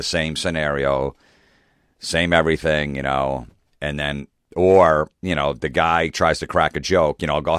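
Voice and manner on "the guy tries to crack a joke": normal talking voice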